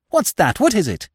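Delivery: high, startled questions; alarmed stammer. A narrator's reading. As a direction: blurt it out in alarm. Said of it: "stammered the widow".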